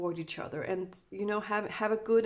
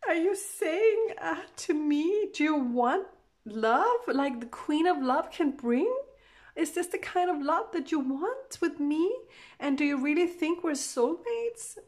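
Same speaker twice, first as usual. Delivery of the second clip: much higher, louder, half-crying plea; voicing a hurt woman near tears, asking if he means it; tearful pleading; 7 semitones higher, 3 dB louder than usual. Reader voicing Divine Feminine asking her partner, tearful high plea. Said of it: "she's crying".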